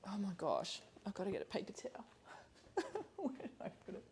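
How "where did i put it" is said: laughing. Real, not acted. Hunting for paper towel, laughing.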